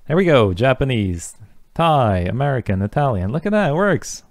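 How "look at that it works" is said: jumps way up in pitch, delighted it works; real little burst of joy at working code; bright high "look at that"; pleased surprise. Real, not acted. Code finally runs after testing.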